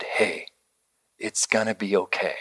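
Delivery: calm him down gently; tender comfort. Retold or imitated. Father comforting son.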